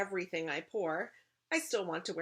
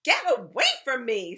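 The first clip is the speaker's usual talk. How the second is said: high, loud shriek of rejection; storybook character screaming in annoyance; angry scream; 7 semitones higher, 14 dB louder than usual. A narrator's reading. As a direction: scream to drive someone off. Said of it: "screams Violet".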